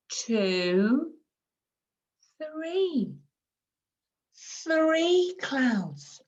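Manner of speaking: put-on slow, high sing-song counting for a small child; modelling language. Shown as a demonstration. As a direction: stretch each number with a swooping lilt. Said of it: "we might say something like".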